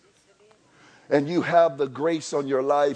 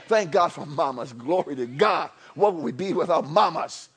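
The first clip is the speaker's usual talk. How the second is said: normal talking voice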